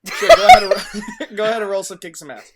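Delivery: words breaking into laughter; laughing. Real, not acted. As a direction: talk through the laughter. GM laughing mid-line.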